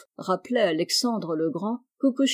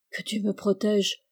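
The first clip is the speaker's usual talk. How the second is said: ordinary delivery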